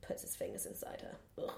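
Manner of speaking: very soft, nearly whispered, then "ugh"; hushed, grossed out. Real, not acted. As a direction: drop to a near-whisper, ending in disgust. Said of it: "the way you said it".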